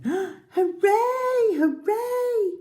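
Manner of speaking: high, loud hooray; excited cheer. Acted. Forest animals celebrate the mouse, high joyful cheer. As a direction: cheer like a crowd of creatures.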